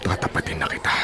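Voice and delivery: low, hushed confiding; a mayor's quiet threat to his vice; hushed warning. Acted. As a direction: lean in and whisper the warning.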